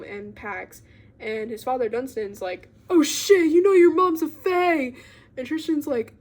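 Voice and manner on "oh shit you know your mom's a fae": higher and louder, caught-out exclamation; mock panicked confession; startled blurt. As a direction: blurt it out in alarm. Father blurts out a secret.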